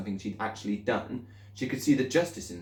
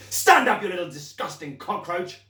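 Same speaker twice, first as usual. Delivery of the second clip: much higher, louder, far-off barked command; angry order; 9 semitones higher, 6 dB louder than usual. Acted. Headmistress ordering the pupil up in anger. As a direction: bark the order.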